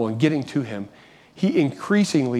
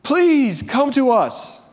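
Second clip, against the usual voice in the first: crying out for help; 11 semitones higher, much slower than usual. Retold or imitated. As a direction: beg the rescuer to come. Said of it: "they cried out and said".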